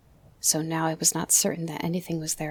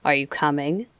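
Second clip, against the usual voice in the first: louder, cold South African persuasion; sharp South African accent; 5 dB louder than usual. Acted. Opal miner urging the boy to surrender, louder South African accent. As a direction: coax him to give up in a South African accent. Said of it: "said the dead man".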